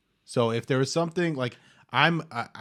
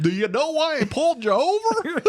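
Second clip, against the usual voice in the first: high, loud silly clown cop; 5 dB louder than usual. Pretend. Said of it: "talk in a clown voice".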